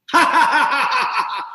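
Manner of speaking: high, loud mocking cackle; mocking laugh. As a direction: laugh down at him. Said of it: "all of a sudden I heard".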